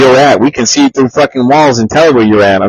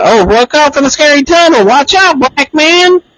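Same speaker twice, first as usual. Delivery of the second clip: much higher mock warning voice; imitating Chappelle bit. Quoting a Dave Chappelle sketch, high mock warning. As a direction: squeak out a nervous warning. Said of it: "the Dave Chappelle guy".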